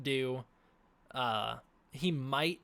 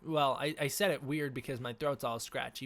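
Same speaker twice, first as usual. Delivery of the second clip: normal talking voice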